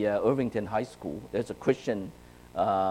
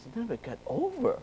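put-on higher, softer voice of a sulky boy; upset boy; 10 semitones higher, 9 dB quieter than usual. Boy complaining to his mother, sulky and high. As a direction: whine it back at mom.

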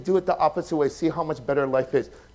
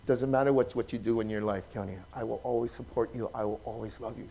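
tender father; 6 semitones lower, 10 dB quieter than usual. Retold or imitated. Father speaking tenderly to Keone.

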